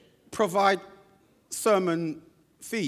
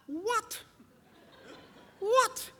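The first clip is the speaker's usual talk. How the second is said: high, incredulous What with a laugh; astonished; 4 dB quieter than usual. Retold or imitated. Preacher retelling his shock at the colored question. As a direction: jump way up in disbelief. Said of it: "And I thought".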